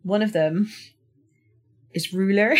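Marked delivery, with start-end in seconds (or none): 2.1-2.6 "ruler" attempted with muddled R's, rising at the end; struggling to pronounce "ruler". Shown as a demonstration. Showing co-host a word they can't say, muddled R's in "ruler". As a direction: trip over the R's in "ruler". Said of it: "there are certain words".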